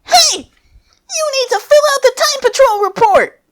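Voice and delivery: very high, loud squeaky shout; shouting Cronoa. Acted. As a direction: call out after him way up high. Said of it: "she shouts".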